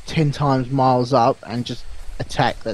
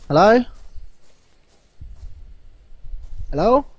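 raised questioning call; 9 semitones higher than usual. Real, not acted. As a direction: call out Hello up high. Calling out to unseen player.

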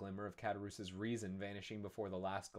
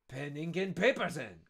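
raised, louder call; calling loudly; 6 semitones higher, 7 dB louder than usual. Acted. Fernand calling the waiter for writing things, loud. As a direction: call out across the room. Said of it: "called Fernand loudly".

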